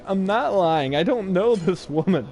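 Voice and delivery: put-on protesting kid. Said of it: "And I'm like".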